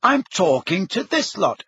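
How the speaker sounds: high-pitched indignant protest; outraged. A narrator's reading. Ginny protesting at her mother's summons, outraged. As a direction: object in outrage, pitch shooting up.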